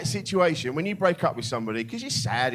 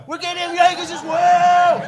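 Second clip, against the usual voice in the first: very high, loud mock shout; insistent yell; 14 semitones higher, 8 dB louder than usual. Pushy friend overruling a parent with kids, imitated insistent yell. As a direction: imitate the unstoppable mate, yelling over all objections.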